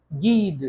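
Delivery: गीद: ग जैसे गर्ल, अंत में द; French word guide. Shown as a demonstration. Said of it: "guide French में प्रोनाउंस होता है कैसे". (गीद फ्रेंच उच्चारण में, ज़ोर से)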